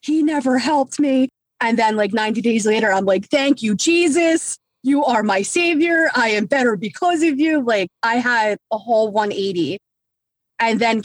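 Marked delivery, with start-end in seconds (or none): 0.0-1.3 higher-pitched retold resentment at God; mock-defiant grievance, pitched up; resentful, angry. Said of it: "resentful and so angry". 3.3-7.6 high-pitched mock-devout praise; exaggerated born-again thankfulness, pitched up; hammed-up gratitude. Converted, praising Jesus after resentment.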